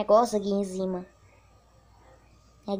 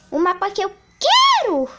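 excited shout; 7 semitones higher, 9 dB louder than usual. Real, not acted. Voting for favourite map.

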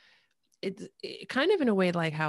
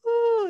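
high, loud cooing "ooh"; sudden high-pitched ooh; delighted "ooh"; 11 dB louder than usual. Retold or imitated. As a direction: let out a high squeal of recognition. Her delight at a familiar accent.

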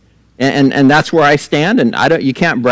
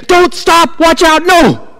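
high-pitched panicked yelling; freaking out; 14 semitones higher, 3 dB louder, slower than usual. Acted. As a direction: cry out in acted alarm, much higher. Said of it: "He's freaking out".

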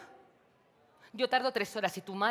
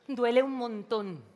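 louder, slow and higher, aching; pained complaint; 5 semitones higher, 7 dB louder than usual. Acted. María whines to her sister that the sting hurts, loud and slow. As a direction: drag it out in pain.

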